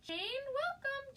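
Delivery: high, slow, drawn-out welcome; stretched, high-pitched greeting to a new viewer; excited welcome. Real, not acted. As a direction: call out warmly to Jane as she joins.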